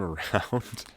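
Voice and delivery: ordinary delivery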